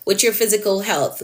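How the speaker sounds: usual speaking voice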